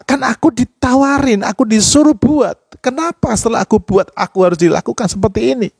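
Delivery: high, pained protest, acted; crying out to God. Preacher reenacting his complaint to God, anguished high-pitched plea. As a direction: plead upward like a hurt child, without shouting. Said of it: "Wah, saya teriak".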